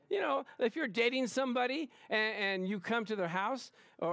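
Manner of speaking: usual speaking voice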